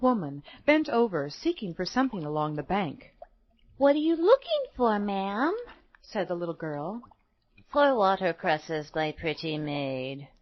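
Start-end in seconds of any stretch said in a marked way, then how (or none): 3.8-5.6 lightly voiced girl, starting high; curious little girl. A narrator's reading. Little girl questions an old woman. 7.7-10.3 slow, lightly voiced old woman; mumbling old woman. A narrator's reading. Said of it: "mumbled the poor old woman".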